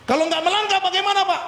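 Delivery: high, quick acted question; imagined questioner. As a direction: mimic a listener piping up to ask. Acts out a listener's question. (menirukan jemaat bertanya, nada tinggi)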